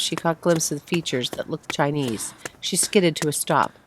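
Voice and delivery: ordinary delivery, nothing marked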